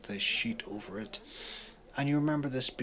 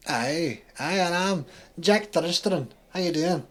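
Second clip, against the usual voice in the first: loud, high Scottish salesman; cheery thick Scottish accent; 7 semitones higher, 6 dB louder than usual. Acted. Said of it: "He has a thick Scottish accent".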